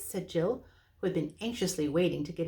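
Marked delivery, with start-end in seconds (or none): none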